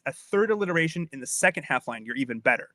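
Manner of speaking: ordinary talk, nothing marked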